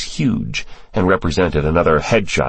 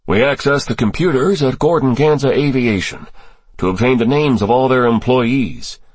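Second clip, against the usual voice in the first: assured answer in a higher register; unhesitating; 7 semitones higher than usual. A narrator's reading. Cross answering the senator on his sources, without hesitation, higher voice. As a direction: answer readily, with nothing to hide. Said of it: "Cross answered without the slightest hesitation".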